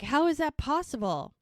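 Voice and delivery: disbelieving high exclaim; surprised. Retold or imitated. Firefighters stunned at how fast it spreads, surprised. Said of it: "they're like".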